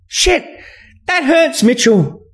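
high-pitched hurt protest; pained female character. A narrator's reading. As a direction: complain in pain, far up in pitch. Woman cries out in pain.

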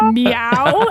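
dramatic, drawn-out mock meow; imitating Sabretooth. Co-host retelling what made them laugh, dramatic meow. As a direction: play a meow as a grave line. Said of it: "Sabretooth dramatically says".

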